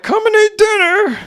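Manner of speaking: very high mom voice calling out. Retold or imitated. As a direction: call the kid to dinner like mom. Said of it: "my mom was just like".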